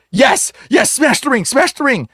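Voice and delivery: high, insistent retold plea; urgent begging. Afflicted man begs helper to destroy his ring, urgently. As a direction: urge it on in a mock frenzy.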